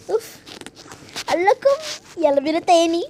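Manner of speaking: ordinary delivery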